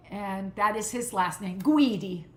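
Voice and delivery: ordinary talk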